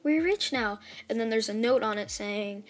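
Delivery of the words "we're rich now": surprised. Retold or imitated. Acting out characters finding money.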